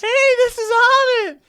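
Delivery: very high, loud, drawn-out whale call; whale impersonation. Acted. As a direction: bellow it slowly like a singing whale. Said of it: "that was a really good whale impersonation".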